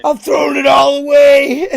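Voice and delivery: loud, high melodramatic wail; mock despair. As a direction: imitate a friend in hammed-up anguish. Mocking a friend's supposed despair.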